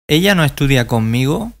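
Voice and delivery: ordinary talk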